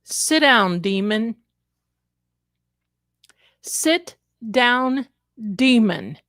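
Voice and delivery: firm, commanding rebuke to a demon; retold command. Vlogger retelling what she told the TV, stern. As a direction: order the demon down. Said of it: "this is exactly what I said".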